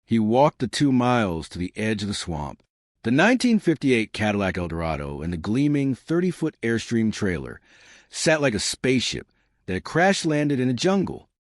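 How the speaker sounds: ordinary delivery, nothing marked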